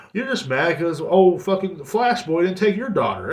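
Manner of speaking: ordinary talk, nothing marked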